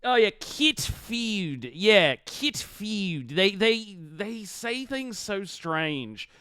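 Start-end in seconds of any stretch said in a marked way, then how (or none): 0.0-3.3 slow South African "kit feud" for cat food; drawn-out South African kit feud, vowels bent; South African accent. Retold or imitated. Quoting the District 9 guy in a South African accent. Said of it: "the guy in District nine saying cat food".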